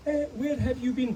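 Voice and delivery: high-pitched mock Queen's English; Queen accent. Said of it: "That's my Queen accent".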